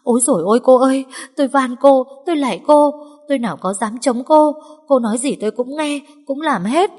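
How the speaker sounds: high, loud, fast wailing plea; tearful begging. Acted. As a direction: beg for your life on your knees. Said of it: "khóc lóc van xin".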